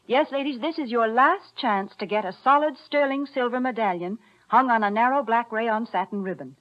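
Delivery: ordinary delivery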